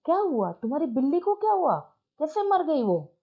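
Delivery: high, quick fake worry; feigned concern. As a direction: needle him with mock concern. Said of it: "पारण पूछता है जानबूझ के".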